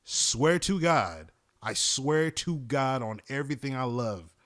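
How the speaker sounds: drawn-out, insistent swearing; slow and solemn, swearing on everything; slow emphatic oath. Real, not acted. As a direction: stretch each word to insist it's true. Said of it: "swearing it's true".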